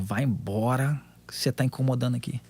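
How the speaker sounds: low, hushed demon voice, lightly acted; retold demon. Demon at the table telling the son to leave, retold low and quiet. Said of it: "O demônio manifestou na mesa de novo".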